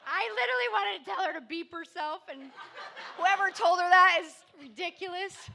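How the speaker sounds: laughing high and loud. Real, not acted. Speaker laughing about his sister's advice, real laugh. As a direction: laugh through the frustration. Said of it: "which that's always the worst".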